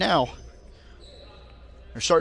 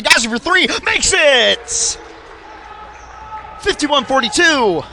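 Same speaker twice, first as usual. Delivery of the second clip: excited call; 9 semitones higher, 6 dB louder than usual. Acted. Made three-pointer.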